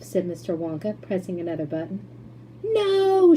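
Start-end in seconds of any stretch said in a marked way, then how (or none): 2.6-3.3 high, loud panicked no; shout. A narrator's reading. Charlie shouting at Mister Wonka to stop. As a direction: yell to stop it. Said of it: "shouted Charlie".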